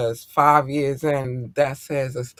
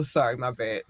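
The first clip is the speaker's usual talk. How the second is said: ordinary delivery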